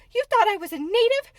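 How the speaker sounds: put-on girl shouting in furious disbelief. A narrator's reading. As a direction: hurl the question in shock. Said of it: "Mary sat up in bed furious".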